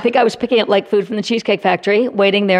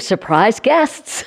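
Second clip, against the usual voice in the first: mock teasing; much slower than usual. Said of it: "they kept saying to Steve".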